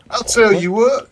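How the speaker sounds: mock southern accent. Showing friend how it should sound, mock southern accent. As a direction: lift it into a put-on southern accent. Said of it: "No, no, no, it's more like a".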